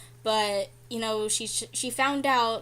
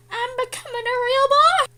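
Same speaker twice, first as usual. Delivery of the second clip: much higher and louder, a cartoonish squeal; very high squeaky voice; 13 semitones higher, 11 dB louder than usual. Celebrating darker hair to viewers, very high squeal. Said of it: "I won't be able to go as high as I just did".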